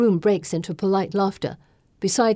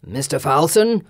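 cold, lower lord voice, rising; stern lord voice; 3 semitones lower, 4 dB louder than usual. Acted. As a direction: rebuke him by name. Lord rebukes Falson.

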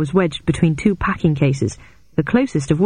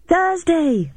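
high-pitched excited call; excited shout; 12 semitones higher than usual. A narrator's reading. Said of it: "I shouted excitedly".

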